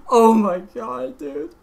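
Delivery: real tearful laugh, high and breaking; crying and laughing. Real, not acted. Gamer still in tears over his full combo, crying and laughing. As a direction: let a sob turn into a laugh. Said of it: "I'm literally fucking crying right now".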